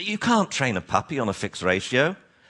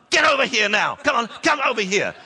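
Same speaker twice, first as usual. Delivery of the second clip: demo angry call; 10 semitones higher than usual. Shown as a demonstration. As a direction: snap the order at your neighbor. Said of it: "tell them".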